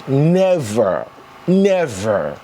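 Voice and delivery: "Never, never" as two separate drawn-out falls; emphatic repetition. Real, not acted. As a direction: land each "never" on its own with a long falling tune. Emphatic repeat driving the point home.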